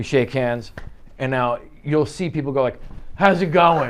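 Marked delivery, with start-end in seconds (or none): 3.1-3.9 louder, higher mock-hearty hello; overbearing greeting. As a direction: imitate a pushy hand-shaker saying hello. Dominant handshaker's greeting imitated.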